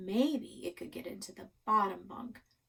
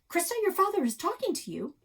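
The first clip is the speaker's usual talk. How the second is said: sharp mom; 10 semitones higher, 6 dB louder, faster than usual. A narrator's reading. Mom snaps at daughter.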